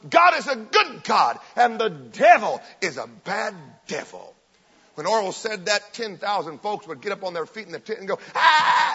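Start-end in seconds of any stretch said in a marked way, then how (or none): 0.1-4.3 slow, emphatic preacher's declaration; Oral Robertson's preaching line. Retold or imitated. Preacher giving his famous line to the tent, slow and forceful. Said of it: "Oral Robertson's was". 8.3-8.9 high, loud yell of a roused crowd; crowd scream. Retold or imitated. Tent crowd answering the preacher, screaming. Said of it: "and scream and yell and shout".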